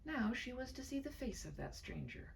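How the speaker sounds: usual speaking voice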